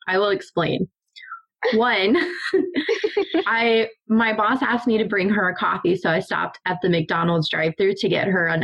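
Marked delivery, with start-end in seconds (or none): 2.2-3.4 high laugh. Real, not acted. Start of the coffee story.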